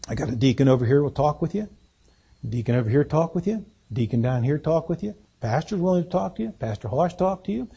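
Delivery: soft, quick reassuring altar call; gentle invitation. Acted. Pastor gently inviting doubters to talk with deacons. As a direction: invite them in kindly.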